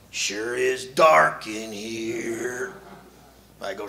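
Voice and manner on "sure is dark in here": put-on slow, drawn-out kid voice; little boy voice. Boy in the closet, slow childish voice. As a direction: sound like a small child in the dark.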